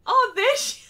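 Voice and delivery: sudden high, loud exclamation; excited shout. Real, not acted. Streamer spotting the viewer who likes to lurk, excited shout. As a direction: burst out in delighted discovery.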